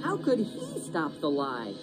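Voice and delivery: usual speaking voice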